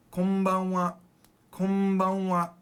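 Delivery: Konbanwa as kombanwa, m held before b; n sounding like m. Shown as a demonstration. Teacher showing learners the sound, n near m in Konbanwa. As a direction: hum the n of Konbanwa into the b. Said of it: "that N almost sounds like an M".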